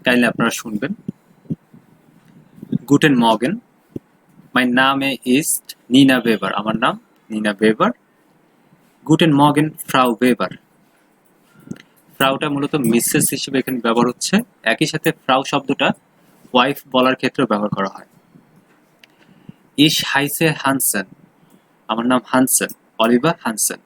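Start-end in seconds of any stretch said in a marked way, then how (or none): 2.9-6.5 slow, careful reading for students to copy; unhurried teacher read-aloud; model reading. Shown as a demonstration. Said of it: "আমি আবারও এটা পড়ছি, kindly আপনারা শুনবেন". 19.9-21.1 slow, careful classroom read; model reading. Shown as a demonstration. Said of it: "আমি আবারও এটা পড়ছি".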